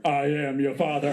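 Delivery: low, booming Vader voice; Darth Vader. Retold or imitated. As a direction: drop into the Vader line. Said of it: "like Darth Vader".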